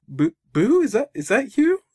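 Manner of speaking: high, loud astonished question; stunned surprise. Retold or imitated. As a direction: gasp out the question in disbelief. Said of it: "Mike is like, he's stunned".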